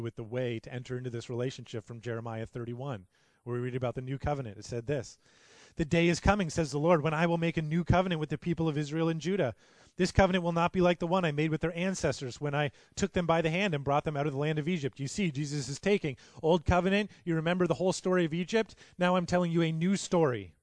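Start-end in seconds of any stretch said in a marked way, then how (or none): 5.8-15.0 raised, declaiming scripture; ringing pulpit reading of scripture. Acted. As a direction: proclaim the prophecy with rising fervor. Reads Jeremiah with rising force.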